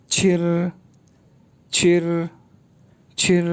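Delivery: छिर said with an aspirated retroflex ch and a curled r; छिर with a puff of air; छिर like the Mandarin syllable chi; pinyin chi. Shown as a demonstration. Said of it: "सी एच की sound".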